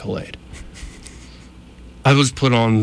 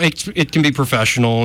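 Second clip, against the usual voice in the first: ordinary talk, nothing marked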